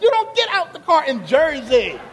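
hammed-up shout of alarm; mock alarmed warning. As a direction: warn him he'll die if he gets out. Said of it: "He's like".

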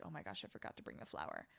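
whispered, low and breathless; panicked whisper. Retold or imitated. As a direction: hiss it out in dismay. Retold panic to her mom.

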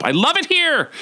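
very high, gushing exclamation; gushing delight. Retold or imitated. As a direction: squeal it with delight. Retells gushing delight at the place.

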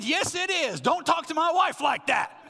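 high, loud yell down the phone; angry shout. Retold or imitated. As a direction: shout it back at full pitch, defending your wife. Yelling back at father-in-law, retold.

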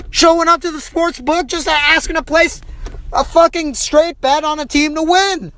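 high-pitched indignant rant, pitch climbing well above normal; incensed rant. Real, not acted. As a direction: rant at the absurdity, voice pushed way up. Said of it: "I'm incensed".

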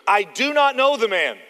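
loud, high, hammered denial; acted emphatic protest; vehement denial. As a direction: deny it forcefully, word by word. Acts Peter's forceful sworn denial.

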